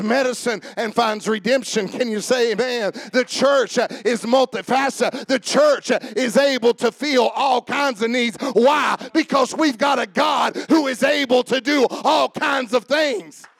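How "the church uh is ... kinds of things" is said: raised, punchy preacher delivery; building toward a preacher's shout; fired-up preaching. Real, not acted. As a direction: drive each phrase home like a revival preacher.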